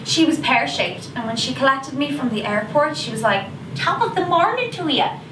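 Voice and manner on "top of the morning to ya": higher-pitched, hammy Irish greeting; imitated top-of-the-morning fake Irish lilt; bad Irish accent. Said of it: "in a really bad Irish accent".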